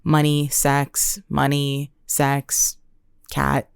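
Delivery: lightly voiced brooding vampire, low and slow; a hint of Edward Cullen pausing over each word. Comic on deleting a post, a touch of Edward Cullen. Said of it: "Edward Cullen in that scene when he was like".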